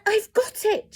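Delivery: high, loud eureka cry; excited cry. A narrator's reading. Snail crying out her plan, high and loud. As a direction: burst out with the idea. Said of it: "she cried".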